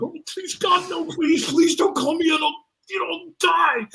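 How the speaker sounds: high, strained mock begging; hammed-up terror, begging to be spared; panicked pleading. Acting out his silent panicked prayer.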